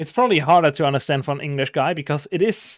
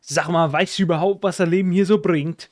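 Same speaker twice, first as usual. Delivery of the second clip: thick Northern German dialect, a bit higher and louder; broad Northern German accent, lively; 3 semitones higher, 3 dB louder than usual. Shown as a demonstration. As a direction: say it the way the Northern German rapper does. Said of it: "He's like".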